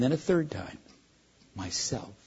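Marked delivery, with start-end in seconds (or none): 1.5-2.1 much softer, barely heard whisper; whispered very softly. Acted. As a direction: breathe it like rustling leaves. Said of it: "whispered so softly you could hardly hear it".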